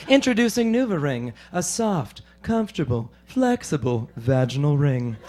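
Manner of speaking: a light send-up of a sultry ad voiceover; commercial announcer. Retold or imitated. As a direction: purr it like a TV ad announcer. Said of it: "the announcer goes".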